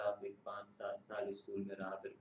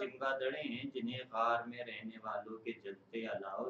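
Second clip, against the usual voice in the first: measured, solemn poem reading; stately nazm recitation; 4 semitones higher, 3 dB louder than usual. Acted.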